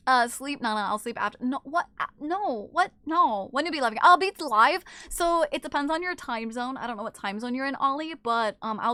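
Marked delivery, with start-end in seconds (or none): none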